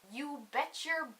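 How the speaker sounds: normal talking voice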